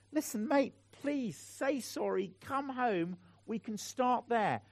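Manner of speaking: tender plea. Retold or imitated. Pleading with the man on phone.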